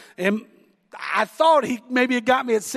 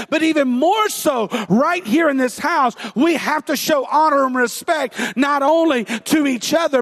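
louder, driving sermon delivery; preaching at full force; 2 semitones higher, 4 dB louder than usual. Acted. Pastor exhorting the church to honor leaders, preaching loud and firm. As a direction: press the point on the congregation.

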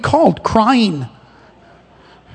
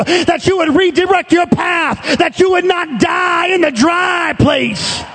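high, ringing preacher's cry building to a shout; full-force preaching; 8 semitones higher than usual. Acted. Preacher driving his church toward the right place, full-force preaching. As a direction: preach it out over the congregation.

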